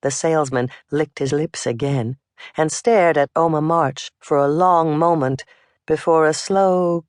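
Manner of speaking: ordinary delivery, nothing marked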